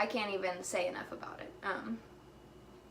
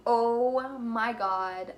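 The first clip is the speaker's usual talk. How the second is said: long drawn-out, louder "oh my God"; awestruck; 2 semitones higher, 7 dB louder, much slower than usual. Retold or imitated. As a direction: stretch it out in retold awe. Acted awe at seeing newborn babies.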